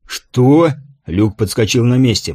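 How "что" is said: higher, sharp startled question; startled outburst. A narrator's reading. Luke hearing of his impersonator, a startled high cry. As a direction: burst out in disbelief.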